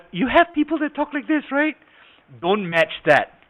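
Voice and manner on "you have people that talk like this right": very high, loud cartoon-mouse voice; high-pitched voice. Shown as a demonstration. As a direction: push it up like Mickey Mouse.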